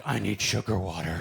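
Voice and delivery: low, slow, lightly voiced alien. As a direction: drag out the words like a stiff alien.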